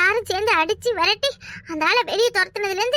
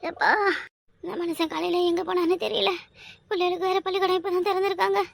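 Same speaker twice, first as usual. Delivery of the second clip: quiet, drained groaning complaint; weak and ailing; 5 dB quieter than usual. Acted. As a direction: moan through sudden pain. Woman suddenly ill, groaning.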